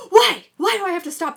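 very high, loud protest; indignant shout. Retold or imitated. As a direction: demand it loudly, outraged. Her protest quoted.

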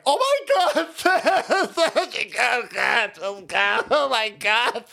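high, whimpering mock panic; mocking scared whiner. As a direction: squeal like a frightened coward. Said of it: "now you got these pussies over here like".

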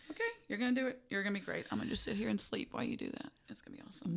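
softly fading self-reassurance; calm soft self-talk. Retold or imitated. Quoting her calm self-talk.

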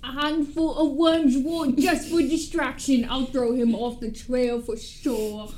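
hammed-up Cockney scheming; stupid Cockney accent. Sally adding worm's wart to distract him, Cockney accent. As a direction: play it in broad, butchered Cockney. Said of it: "let's do the stupid Cockney one".

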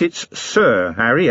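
slow, weighty "it's Sir Harry"; stress on Sir. Acted. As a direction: lean hard on "Sir" so they remember. Harry insists on the title.

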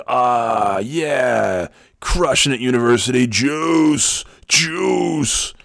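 high, drawn-out hyped cheer; intense coworker impression. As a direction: cheer him on as the intense salesman. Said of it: "And be like".

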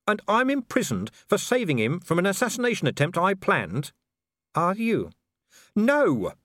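a man defending the obvious; exasperated Vimes. A narrator's reading.

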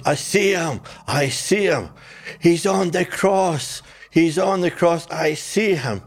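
high-pitched, half-hearted mock yelling; retold screaming. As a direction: imitate a man crying out, without full volume. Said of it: "he's screaming and he's hollering and he's yelling".

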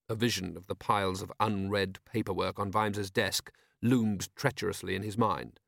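usual speaking voice

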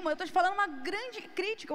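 ordinary talk

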